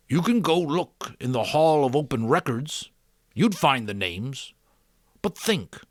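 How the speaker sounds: a hint of a patient teacher questioning a boy; lightly voiced old mentor. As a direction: gently lead the boy to think. The giver explains to Jonas.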